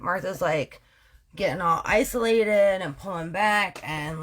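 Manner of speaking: usual speaking voice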